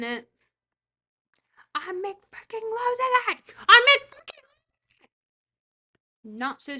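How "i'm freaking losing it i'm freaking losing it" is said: high-pitched, very loud outburst; frantic shout. Real, not acted. Streamer losing it over the game, frantic shout. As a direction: yell it as if completely losing it. Escalates the same words into shouting.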